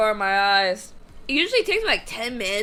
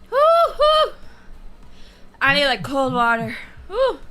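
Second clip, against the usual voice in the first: panting whoops. Real, not acted. Breathing through chili burn.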